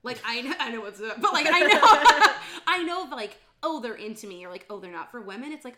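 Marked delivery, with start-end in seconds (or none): none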